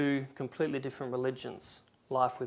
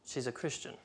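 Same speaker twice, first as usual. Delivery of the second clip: quiet, guarded reply, softer; cautious; 7 dB quieter than usual. Retold or imitated. Second woman answering about another woman, cautious and quiet. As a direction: say it carefully, holding back. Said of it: "sort of cautiously and said".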